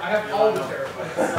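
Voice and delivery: loud, high bragging boast; mock boast. Real, not acted. Bragging about computer storage.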